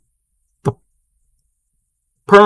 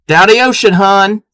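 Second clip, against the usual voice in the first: higher Maryland "downy ocean, hon"; Maryland accent; 5 semitones higher than usual. Shown as a demonstration. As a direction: greet like a Maryland local, pitched up. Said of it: "we, we say".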